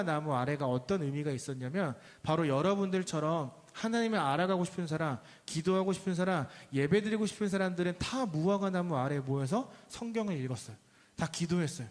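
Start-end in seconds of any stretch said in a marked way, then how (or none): none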